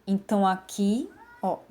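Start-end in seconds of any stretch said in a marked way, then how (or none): none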